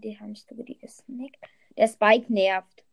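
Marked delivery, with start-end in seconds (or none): 0.0-1.4 breathy whispered aside; whispering. Real, not acted. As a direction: whisper it to yourself. Muttered aside mid-game.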